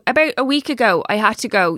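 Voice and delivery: usual speaking voice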